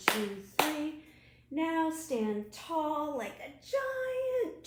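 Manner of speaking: put-on bouncy chant for children; action rhyme. Librarian leads children in an action rhyme, bouncy chant. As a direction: lead the kids through moving.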